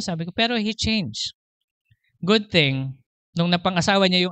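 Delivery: ordinary talk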